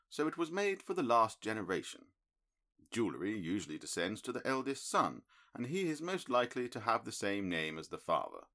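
measured, mildly disappointed sleuth; a hint of a cool analytical detective; Holmes, lightly voiced. A narrator's reading. Holmes laying out deductions.

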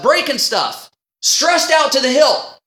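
ordinary talk

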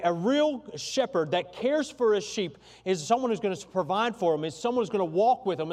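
loud, high preaching voice. Acted. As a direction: preach it with building fervor. Preaching with rising force.

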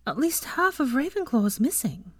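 high-pitched puzzled musing. A narrator's reading. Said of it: "she mused".

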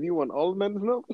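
lightly imitated Jamaican voice; Jamaican accent. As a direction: quote him in a light Jamaican lilt. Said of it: "he said".

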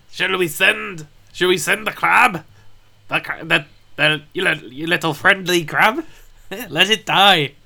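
high, loud character voice; Helga voice. Acted. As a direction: voice Helga big and theatrical.

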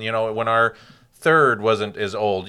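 ordinary talk

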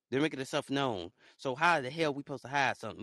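normal talking voice

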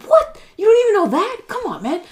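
high, loud, shocked what; shocked disbelief. Retold or imitated. Retelling her reaction to Roberto, shocked and high. Retold shock he doesn't know.

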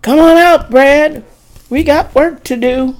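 high, loud call across the yard; shouted. Acted. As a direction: shout it out to the house. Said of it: "Someone shouted".

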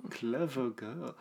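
slow, soft movie-line delivery; drawn-out film quote. Retold or imitated. Trading Jurassic Park quotes with the co-host, slow and soft. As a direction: stretch both words out, softly.

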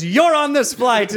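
put-on loud, high announcement in a Swiss accent. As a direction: imitate a Swiss agent proclaiming good news. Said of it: "in his great Swiss accent".

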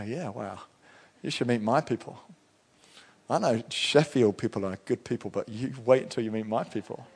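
soft, hushed retold boast; quietly proud. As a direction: brag softly about your own people. Smugly proud reply to other pastors.

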